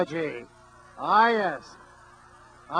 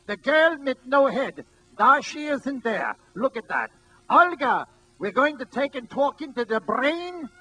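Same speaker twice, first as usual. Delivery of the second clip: put-on old carnival barker; 5 semitones higher than usual. Imitating the old showman calling the crowd in, barker voice. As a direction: bark it like an old-time carnival talker. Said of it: "This is the way he sounded".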